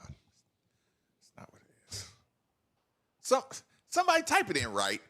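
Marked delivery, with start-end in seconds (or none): 0.0-2.1 slow whispered correction. Real, not acted. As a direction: whisper it under your breath. Corrects friend's translation.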